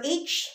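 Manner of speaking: H named "AH-cheh"; Spanish letter name. Shown as a demonstration. Teacher explaining our H to learners, Spanish letter name. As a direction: say H as Spanish "hache", silent first letter. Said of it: "our H is pronounced as".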